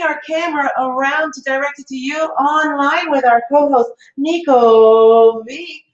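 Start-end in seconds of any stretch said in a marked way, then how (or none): none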